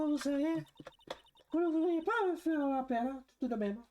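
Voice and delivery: very high falsetto, teasing reproach; mock-stern flirty witness; coy scolding witness.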